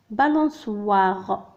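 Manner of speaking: syllable by syllable, slowly; slow repeat. Shown as a demonstration. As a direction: spell it out with the voice. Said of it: "Une balançoire".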